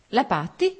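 a pleased, startled repeat of the name; surprised echo. A narrator's reading. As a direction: caught off guard by the idea. Anna echoes the name, surprised.

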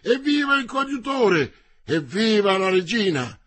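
high, loud crowd-style cheers; cheering shouts. A narrator's reading. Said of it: "Poi risuonarono le grida".

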